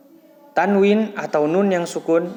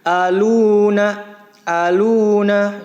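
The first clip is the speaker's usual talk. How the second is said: "Aluna" slow, higher, louder, with long "lu"; one-beat lengthening; 5 semitones higher, 6 dB louder, much slower than usual. Shown as a demonstration. Teacher models domah followed by waw, drawn-out recitation. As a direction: chant "Aluna", stretching the u. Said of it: "dibaca panjang satu ayunan".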